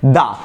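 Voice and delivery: দা, "the" with an open "uh/ah" vowel. Shown as a demonstration. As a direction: say দা as "dha", the form before consonants. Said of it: "সব কিছুর সাথে উচ্চারণ হবে". ("দা", আ-কার দিয়ে উচ্চারণ)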